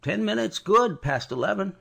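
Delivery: rough coaching man's voice; gruff coachman. Acted. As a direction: bark it like a tired coachman.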